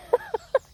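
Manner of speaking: high-pitched short giggle; real giggle at your own remark; amused laugh. Real, not acted. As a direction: burst into a quick high laugh.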